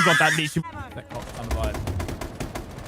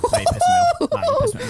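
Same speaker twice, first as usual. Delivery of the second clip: much higher, squeaky voice; squeaky mock grudge. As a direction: squeak it like a cartoon sulk. Repeating the line in different voices.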